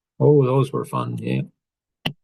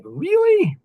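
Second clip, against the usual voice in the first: squeaky incredulous Really, far higher; a hammed-up gasp of disbelief; high, loud, mock-shocked Really; 5 dB louder than usual.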